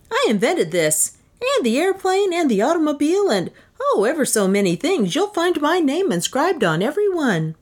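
high-pitched, swooping inventor voice; boastful eccentric inventor. Acted. Inventor shows off his creations to visitor, boastful.

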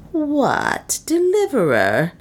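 high, mocking sneer; satirical. A narrator's reading. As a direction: sneer the title at him. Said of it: "said a satirical voice behind him".

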